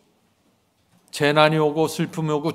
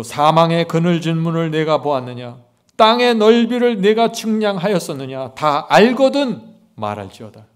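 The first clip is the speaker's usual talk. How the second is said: scripture reading; 7 dB louder than usual. Acted. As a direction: read the verse like a proclamation.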